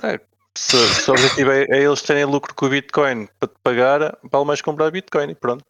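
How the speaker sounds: ordinary talk, nothing marked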